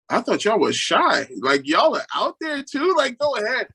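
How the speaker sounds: high-pitched mock disbelief; playful teasing. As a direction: tease them, pitch leaping up. Teasing his shy kids.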